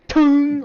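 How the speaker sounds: high, loud imitated clang; clang sound effect. Rock bounces off the giant's helmet, loud clang noise. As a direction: ring it out like metal struck.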